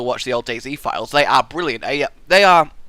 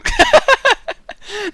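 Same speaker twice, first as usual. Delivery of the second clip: high burst of laughter; 6 dB louder than usual. Real, not acted. Laughing at his own joking answer, high giggle.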